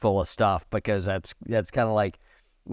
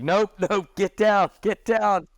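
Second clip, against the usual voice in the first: high, slightly louder retold shout; mock yelling; 10 semitones higher, 4 dB louder than usual. Host imitates the security guard ordering him off, mock yell. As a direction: imitate a yelled order, pitched well up. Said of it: "he keeps yelling at me".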